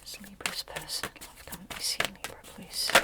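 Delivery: quick, hushed whisper; whispered request. Real, not acted. Reader asking the tarot about Libra's love, whispered. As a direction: whisper it fast, as if privately asking the cards. Murmured question put to the cards.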